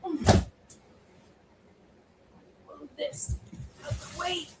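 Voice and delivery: strained while lifting something heavy; effort grunt. Real, not acted. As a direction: push the words out under heavy strain. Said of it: "Sorry for all the grunting".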